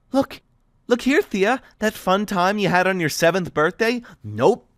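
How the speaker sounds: lightly voiced girl, mock-cheerful and cutting; sarcastic mockery. Thea mocking Aiden's plan, sarcastic and bitter. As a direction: play it like a cruel game show host.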